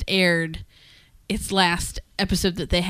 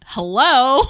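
indignant hello; 5 semitones higher, 8 dB louder than usual. Acted. Feeling ignored by partner.

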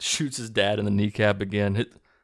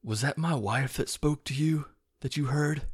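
higher, put-on earnest questioning voice; lightly acted, hopeful movie-character plea; Bradley Cooper; 6 semitones higher than usual. Retelling a husband asking about his wife, in earnest mock plea. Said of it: "Bradley Cooper's like".

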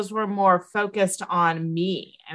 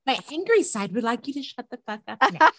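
higher-pitched, joking demand; playful mock demand; 6 semitones higher than usual. Client tries out her line for calls, playful mock demand. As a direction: play the angry side for laughs. Rehearses the joke amid laughter.

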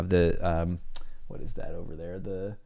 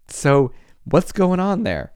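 ordinary talk

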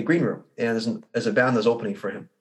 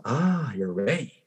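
soft, slow, quiet pep talk to yourself; calm self-reassurance; 2 semitones lower, 8 dB quieter, slower than usual. Retold or imitated. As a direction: settle your own nerves gently, much softer. Bruce soothing himself before stage.

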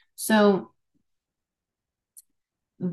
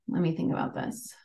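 muttered aside; 4 semitones lower, 7 dB quieter, faster than usual. Real, not acted.

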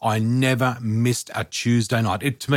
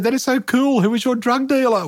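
much higher, teasing disbelief; cheeky mock delight; 12 semitones higher than usual. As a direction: tease her with mock delight. Her cheeky retort, retold.

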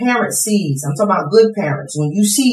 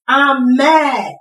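higher-pitched cry of "mad"; angry outcry; 8 semitones higher, 4 dB louder than usual. Acted. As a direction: cry out the anger in an acted prayer. Acted prayer of hurt and anger.

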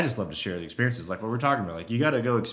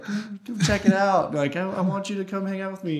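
sweet talk; 9 semitones higher than usual. Retold or imitated. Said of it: "trying to sweet talk him".

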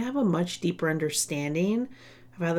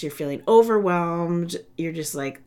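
ordinary delivery

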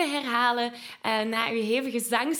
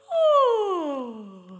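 big open yawn, louder and higher; 4 semitones higher, 5 dB louder than usual. Shown as a demonstration. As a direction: yawn it out on oeh. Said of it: "geef mij eens een, een geeuw".

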